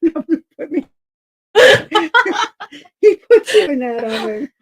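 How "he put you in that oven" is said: laughing through the words. Real, not acted. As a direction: say it while cracking up. Laughing at the joke.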